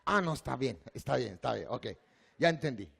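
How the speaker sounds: ordinary talk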